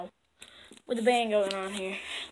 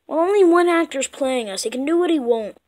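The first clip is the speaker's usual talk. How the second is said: ordinary delivery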